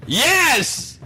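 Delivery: very high, loud, hammed-up glee; over-excited outburst. Boyfriend thrilled by girlfriend's message, hammed-up excitement. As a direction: burst out with squealing eagerness.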